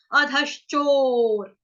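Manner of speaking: अधश्चोर drawn out syllable by syllable; slow demo. Shown as a demonstration. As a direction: stretch अधश्चोर slowly, each syllable apart. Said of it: "उसके बाद च जो देख रहे हो".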